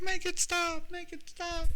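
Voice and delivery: very high-pitched mock wailing; yelling mother. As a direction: imitate a frantic mother in a piercingly high voice. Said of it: "She's, like, yelling".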